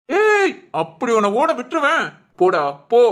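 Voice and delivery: angry shout. Acted. Angry man grabs the fleeing visitor, angry shout. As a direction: stop the runaway with a sharp yell. Catches the man running away.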